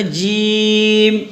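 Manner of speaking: جیم with the ee stretched very long; madd lazim. Shown as a demonstration. Teacher models madd for the class, long stretch. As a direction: draw out the ی of جیم on a steady note. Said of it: "مد لازم کے ساتھ".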